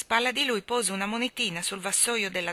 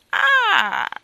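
high, loud cackling "Ah"; clucking cackle; 13 dB louder than usual. Acted. Balloon woman cackles in triumph at Michael, high and loud. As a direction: cluck with triumph like an old hen. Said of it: "chiocciò la donna dei palloni".

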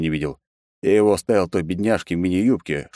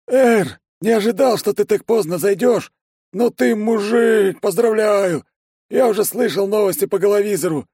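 high excited cheerful voice; much higher, louder, bubbly delivery; Ben's voice; 4 dB louder than usual. Acted. Narrator voices the secretary Ben.

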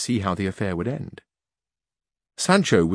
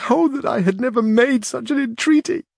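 high wailing lament, louder; lamenting cry; 4 dB louder than usual. A narrator's reading. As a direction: cry out in regret, near sobbing. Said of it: "gave way to a burst of weeping".

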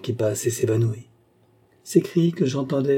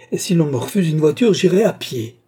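higher, louder, stubborn outburst; defiant insistence; 6 semitones higher, 5 dB louder than usual. Acted. Mother vows to go on foot, defiant outburst. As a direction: insist defiantly with raised pitch. Mother defies those holding her back.

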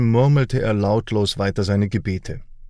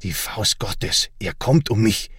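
terrified. A narrator's reading. Terrified Hans sees glowing light.

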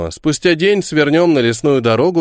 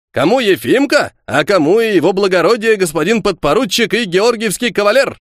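self-important; 7 semitones higher than usual. Acted. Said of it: "важно сказал Кухарев".

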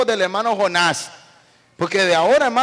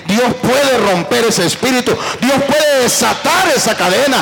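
loud fervent preaching shout; preaching at full force; 5 dB louder than usual. Acted. Preacher rousing the congregation, loud and fervent. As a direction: build to a roaring call for applause.

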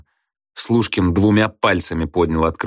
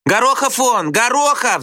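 high, excited schoolkid shouts; back-row yelling. A narrator's reading. Pupils in back rows shout a name, yelling. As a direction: yell the surname out high. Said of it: "заорали с задних парт".

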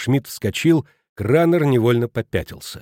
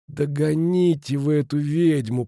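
slow, higher, pained moan; groaning; 4 semitones higher, slower than usual. A narrator's reading. Injured Futaki begging them to stop the woman, groaning. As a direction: groan it out as if hurting. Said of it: "простонал Футаки".

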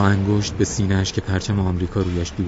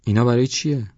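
wondering question, raised pitch; surprised; 5 semitones higher, 3 dB louder than usual. A narrator's reading. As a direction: ask in puzzled amazement. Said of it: "با تعجب".

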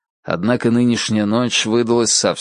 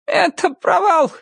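much higher, wailing in despair; wailing lament. A narrator's reading. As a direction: wail like a desperate old conjurer. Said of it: "причитал он".

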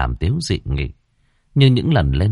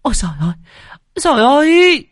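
much higher and louder, piercing shriek; 6 dB louder, faster than usual. A narrator's reading. Mistress drenched by the maid, shrieking. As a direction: scream it as the cold water hits. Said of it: "Bà hai hét toáng cả lên".

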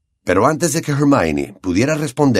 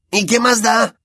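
put-on higher boyish voice, brushing it off; impatient; 12 semitones higher than usual. Ron impatient with Hermione's doubts, dismissive. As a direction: snap it out impatiently. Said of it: "con impaciencia".

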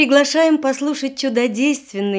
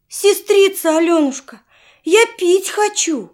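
put-on higher, pleading child's voice; little boy voice; 7 semitones higher, 3 dB louder than usual. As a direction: whine like a thirsty little brother. Little brother begs for water.

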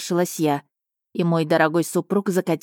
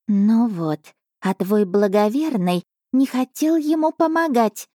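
snorting cat; 5 semitones higher than usual. A narrator's reading. Cat Asha sneers at the emperor, higher sniffy voice. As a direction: sniff at the husband with scorn. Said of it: "фыркнула Аша".

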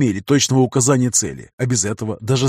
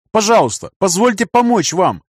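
higher, louder begging cry; desperate pleading; 8 semitones higher, 4 dB louder than usual. Acted. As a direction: beg him urgently. Doctor begs to help.

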